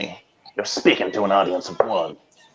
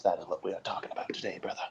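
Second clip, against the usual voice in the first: rushed whisper; 17 dB quieter, much faster than usual. Acted.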